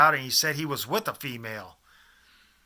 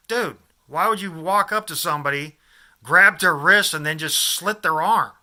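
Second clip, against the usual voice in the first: loud, higher retold remonstrance; appalled protest; 3 semitones higher, 8 dB louder than usual. Storyteller to stranger describing arm slitting, acted appalled protest. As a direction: act out challenging a madman's logic.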